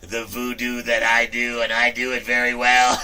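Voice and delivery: cocky boast. Retold or imitated. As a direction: savor each word like a showman. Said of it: "I'm like".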